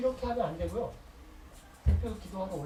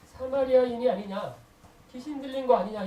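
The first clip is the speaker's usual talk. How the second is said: loud, raised-pitch hostile challenge; acted accusation; 4 semitones higher, 8 dB louder than usual. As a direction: throw it out as an accusing question, voice up. (몰아세우듯 크게 따지는 말투)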